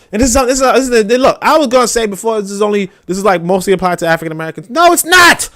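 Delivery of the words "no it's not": high, loud sharp objection; loud protest. Real, not acted. Arguing with himself, a high loud No, it's not. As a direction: snap back high and loud.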